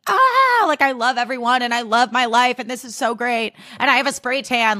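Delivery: high-pitched gush of delight; euphoric high. Retold or imitated. Reliving the high of the trip, euphoric. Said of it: "such a constant high".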